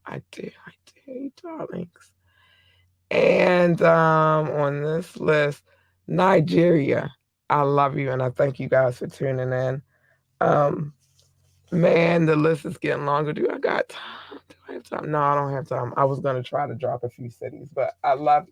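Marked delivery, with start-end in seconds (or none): 0.1-2.1 high, very soft whisper; hushed, airy little aside; whispered affection. Real, not acted. 13.3-15.1 hushed question to oneself; soft, voiceless muttered check; whispered aside. Real, not acted.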